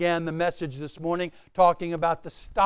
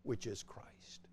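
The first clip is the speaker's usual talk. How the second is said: hushed whisper; 10 semitones lower, 25 dB quieter than usual. Real, not acted. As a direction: drop to a breathy whisper after the climax. Sudden drop after climax.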